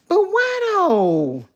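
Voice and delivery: high, slow, stretched out question; drawn-out why. Real, not acted. Asking viewers in disbelief, high and drawn out.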